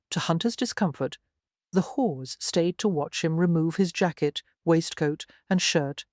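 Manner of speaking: plain reading voice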